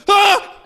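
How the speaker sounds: high startled yelp; mock scream. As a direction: yelp as if cracked. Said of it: "and they go".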